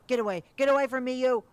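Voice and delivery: high, loud shriek to get away; high, loud terrified shout. Acted. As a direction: scream at the thing to back off. Jim fights off attacker.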